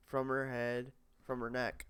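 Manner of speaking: ordinary delivery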